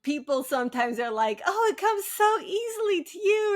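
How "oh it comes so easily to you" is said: high-pitched mock admiration; mimicking admirers. Writer imitating people who think writing is easy, mock admiring.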